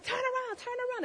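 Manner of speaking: high-pitched urgent mimicry of hospital staff; high, insistent retelling of staff orders; urgent retold command. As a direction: imitate a team urging a patient to turn. Retold medical team rushing in.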